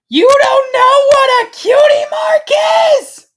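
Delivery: very high, full-volume screech; siren-like shout. A narrator's reading. Shocked filly to the clueless stranger, screeching shout. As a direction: let it blare out like a siren. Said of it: "more like shouts".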